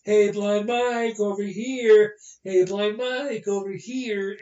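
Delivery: drawn-out crowd call, slow and loud; crowd yelling. Retold or imitated. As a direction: call out across the arena. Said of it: "the crowd would yell".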